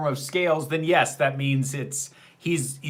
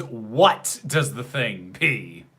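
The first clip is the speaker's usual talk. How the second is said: WHAT drawn out, then slow to the end; slow right down and lean on "what"; stress on WHAT; 3 semitones lower, much slower than usual. Real, not acted. Correcting a viewer's question about the Thing, heavy stress on WHAT. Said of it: "the more important question is".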